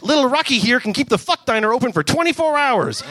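Rocket voice. Acted. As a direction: leer as you boast.

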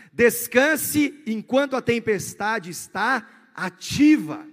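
loud, slow preaching proclamation; full-force preaching. Acted. Pastor calls to the church in a storm, loud preaching.

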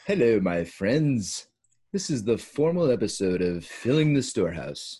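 slow, loud mock Australian-British accent; formal host in a muddled Commonwealth accent; muddled put-on accent. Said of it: "didn't know what that accent is".

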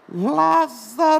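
high, slow echoing call of Lázaro; distant call. Acted. Jesus calling Lazarus from the tomb, echoing. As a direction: call "Lázaro!" from far away.

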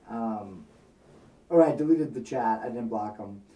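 normal talking voice

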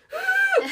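loud, high mock sob; imitated crying gasp. Sister has guessed the pregnancy, mock crying gasp. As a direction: burst into pretend tears on an indrawn breath. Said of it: "started crying all over again".

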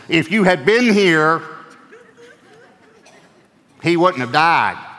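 loud, high, slow accusing cry; a grieving sister's loud, slow rebuke. Shown as a demonstration. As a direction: throw the words at him as a reproach. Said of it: "they might have said it like this".

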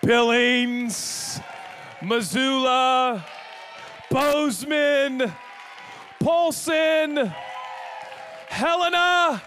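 high, loud, drawn-out roll call. Real, not acted. Preacher greeting church locations, calling out loudly. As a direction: shout each town like an arena announcer.

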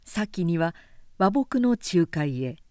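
ordinary delivery

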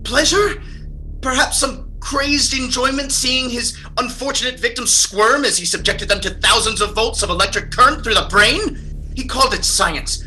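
high, frantic pleading; panicked and desperate to be believed. Acted. Terrified patient describing the murders.

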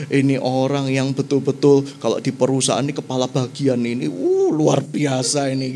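low, pleased king's voice; a king boasting about his best man, voiced low; proud king. King praising Naaman to himself, low and pleased.

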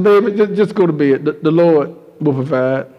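put-on a mother soothing her hungry child to sleep; tender mother. Mother voiced comforting hungry child.

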